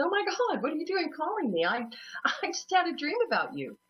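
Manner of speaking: high, astonished phone greeting; quick, high surprise breaking into a laugh; retold amazement. Retold surprise at brother's call.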